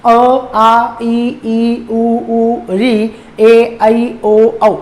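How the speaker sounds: अ आ ई उ ए ऐ ओ औ sounded slowly, mouth always open; teacher repeats the vowel row; vowels repeated as demo. Shown as a demonstration. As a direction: spell each vowel out again for the class. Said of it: "मैं एक बार repeat कर रहा हूं".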